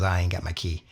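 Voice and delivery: low, softened, unassuming reply; soft-spoken star. Retold or imitated. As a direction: imitate a timid, soft-spoken man. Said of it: "Very soft-spoken".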